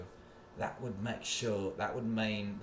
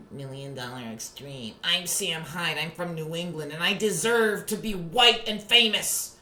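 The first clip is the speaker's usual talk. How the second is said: somewhat higher, mocking Sam Hyde voice; 9 semitones higher, 8 dB louder, slower than usual. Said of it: "that dumbass Sam Hyde".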